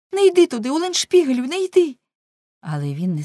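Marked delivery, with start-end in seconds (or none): 0.1-2.0 high, loud desperate begging; frantic plea. A narrator's reading. Girl begging Ulenspiegel not to go, frantic. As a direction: beg him with all your fear. Pleading to hold him back.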